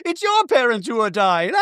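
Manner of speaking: high-pitched cackling impression; Bronwyn. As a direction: mimic her in a high voice, breaking into laughter. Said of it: "And then Bronwyn's like".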